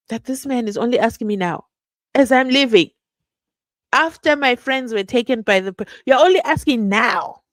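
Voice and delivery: hurt indignation. Retold or imitated. Voices Kwabeni's hurt at late question.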